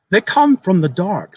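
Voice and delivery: lightly voiced young boy. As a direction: tell it like an eager child sharing a secret. Boy repeats ominously.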